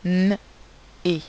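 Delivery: N as a held loud hum, pause, then E; sustained nasal N without a vowel, then a short E; separate sounds. Shown as a demonstration. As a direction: split N and E into two isolated sounds. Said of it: "également deux".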